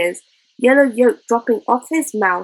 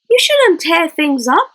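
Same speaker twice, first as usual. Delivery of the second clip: scolding; 8 semitones higher, 6 dB louder than usual. Acted. Joan scolds boy over damage.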